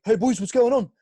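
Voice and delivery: high, loud matey hail; put-on lad greeting. Prankster hailing guys in a car park, loud put-on voice. Retelling his call to strangers.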